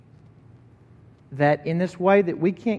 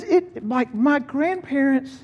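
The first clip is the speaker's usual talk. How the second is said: put-on high, teary old man's voice; humble old farmer, voice high and moved; tearful old man; 9 semitones higher than usual. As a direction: voice an old man choked up, pitched up. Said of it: "he says".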